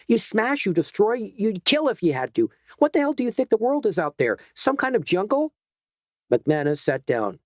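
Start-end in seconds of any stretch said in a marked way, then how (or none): 0.1-5.5 furious tirade. Acted. Store owner berating the gang member who wrecked his shop, rising furious shout. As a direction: pitch climbing high, rising to an angry shout.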